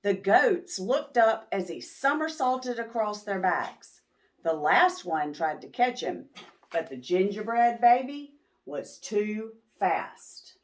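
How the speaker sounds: ordinary delivery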